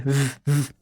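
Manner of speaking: ف voiced, teeth on lip; buzzing V. Shown as a demonstration. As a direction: hold ف as a humming V. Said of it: "تقول كده". (انطق «ف» V والأسنان على الشفة)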